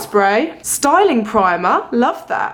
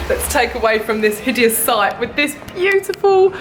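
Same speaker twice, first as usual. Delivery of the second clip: ordinary delivery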